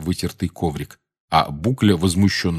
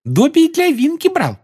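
very high happy squeak; cheerful elf squeak; 6 dB louder than usual. Acted. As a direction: squeak it out gladly. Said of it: "радостно сообщил эльф".

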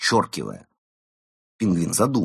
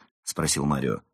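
plain reading voice

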